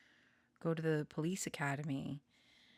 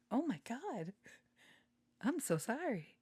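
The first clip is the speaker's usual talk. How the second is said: startled embarrassment; 7 semitones higher than usual. Real, not acted. Startled by own accidental throat squeak.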